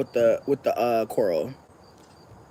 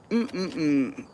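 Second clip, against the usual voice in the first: ordinary delivery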